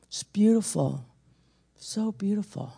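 soft, hushed, eerie admiration; lightly voiced possessed woman, lingering on the words; demon-possessed purr. Preacher imitating the possessed woman stroking his Bible, softly. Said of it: "her voice changed, just a slight octave".

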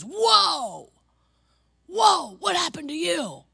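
high, loud mock gasp of shock; mock-shocked high shout; shocked exclaim. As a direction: play the rude woman recoiling in shock. Rude woman exclaiming at preacher.

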